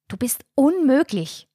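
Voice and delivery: high, exasperated scolding; admonishing. A narrator's reading. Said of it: "mahnte die Mutter".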